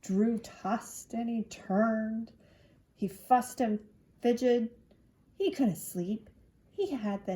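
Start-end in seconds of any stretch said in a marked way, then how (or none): none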